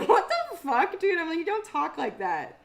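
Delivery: high, loud incredulous outburst; hammed-up, high-pitched bewilderment; mock outrage. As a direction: call out a partner in laughing disbelief. Retold mock outrage at boyfriend's voice.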